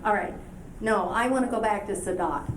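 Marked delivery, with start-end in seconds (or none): none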